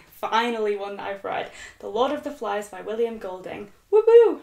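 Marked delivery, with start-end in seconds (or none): 3.9-4.4 high, loud woo-hoo; celebratory cheer. Real, not acted. Reader celebrates finally finding a book she has read, celebratory cheer. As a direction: whoop it out in triumph.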